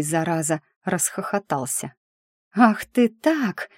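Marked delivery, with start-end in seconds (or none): 2.5-3.7 drawn-out, higher-pitched cry of protest; mock yell. A narrator's reading. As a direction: stretch it out in mild, indignant outcry. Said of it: "завопила я". (негромкий протяжный вопль возмущения)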